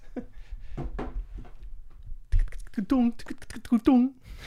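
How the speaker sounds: clicking ticking mouth beeps; computer noises. Pretend. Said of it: "with the computer noises".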